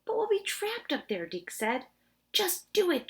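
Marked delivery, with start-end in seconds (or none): 0.0-1.3 high, anxious objection; worried protest. Acted. Dink fears being trapped. 2.3-3.1 high, firm order; insistent command. Acted. Josh insists.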